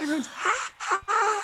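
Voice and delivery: high, soft fake sobbing; mock crying. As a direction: whimper through happy tears. Imagined triumph, crying.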